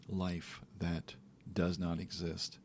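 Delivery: ordinary talk, nothing marked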